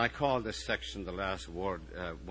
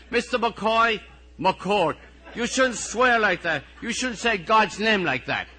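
high, loud scolding; indignant pupil calling the teacher out; reproachful pupil; 13 semitones higher, 16 dB louder, slower than usual. Acted. Pupil telling teacher off for swearing, reproachful.

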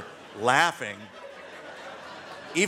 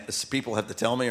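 usual speaking voice

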